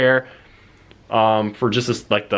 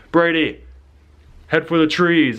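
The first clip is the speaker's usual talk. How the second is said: louder, higher call to run for the trees; urgent call; 6 semitones higher, 3 dB louder than usual. Retold or imitated. Friend calls out to Brady to flee, a raised urgent call. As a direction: shout a warning across the field.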